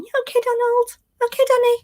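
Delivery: high fussing mock concern. Mocking Candace fussing over Donald, high squeaky voice. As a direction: squeak it out like a fretful fusser. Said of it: "Candace to run around going".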